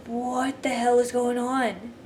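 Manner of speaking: slow, soft bewildered mutter; quietly stunned, drawn out; baffled. Retold or imitated. Baffled at wolves sitting.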